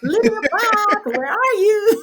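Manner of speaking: high, slow mock shout across a park; mock calling out. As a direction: call out their names like searching for them. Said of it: "I'm not gonna go out there and be like".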